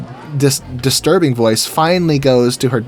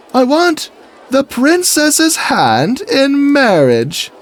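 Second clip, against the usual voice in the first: high, slow, pompous declaration; grand proposal; 12 semitones higher, 3 dB louder, much slower than usual. Retold or imitated. Knight asking guard for princess, hammed-up pomp. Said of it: "the skit goes something like this".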